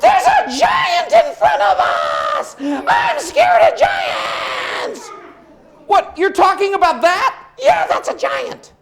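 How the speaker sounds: very high, loud, slow panicked cry; frightened horse shout. Acted. Horse screams about a giant in the desert, very high and loud. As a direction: shriek at the giant.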